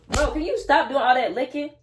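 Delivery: sudden loud, high call at the dog; loud real telling-off of the dog; yelling at the dog. Real, not acted. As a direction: snap at the dog to stop. Yelling at dog mid-recording.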